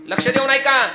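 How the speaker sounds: loud, declamatory kirtan preacher; emphatic sermon delivery; forceful preaching. Real, not acted. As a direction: drive the point out to the congregation.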